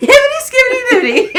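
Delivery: high, loud, fast squeal through laughter; helpless laughter. Real, not acted. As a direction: rattle off the nonsense while cracking up. Cracking up over nonsense words.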